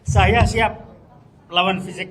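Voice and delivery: a real defiant shout. Real, not acted. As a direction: shout it as a challenge. Vows to fight physically.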